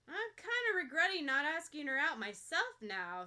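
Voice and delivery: loud, casual musing for all to hear; mock-wistful loud aside. A narrator's reading. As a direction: think aloud far too loudly. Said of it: "muses extremely out loud".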